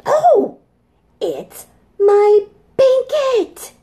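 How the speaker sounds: put-on high, loud, joyful child cry; little dinosaur overjoyed to get his blanket; delighted little dinosaur. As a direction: squeal with relief at finding it.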